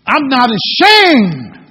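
loud, slow, defiant; hammed-up boast of ashamed drawn out loud; bold declaration. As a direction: stand up and proclaim it proudly. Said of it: "would just stand up and say".

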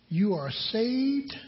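saved drawn out slow and high; slow emphasis. Real, not acted. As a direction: stretch out "saved". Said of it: "slow emphatic preaching".

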